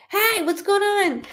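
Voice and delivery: bubbly greeting. Retold or imitated. Imitating the friend walking into the room, bubbly. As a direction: burst into the room greeting everyone. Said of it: "how she is that".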